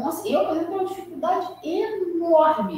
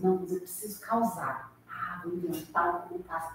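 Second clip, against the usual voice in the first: low, quiet mock inner voice; scheming aside; 8 semitones lower, 8 dB quieter than usual. Medium privately decides to make up a message, scheming aside. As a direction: drop low and soft, as if plotting privately. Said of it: "Mas aí a Carla diz assim".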